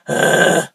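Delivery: harsh pushed sigh; angry sigh. Shown as a demonstration. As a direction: start with an angry breathy sigh. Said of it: "start off with an angry sigh".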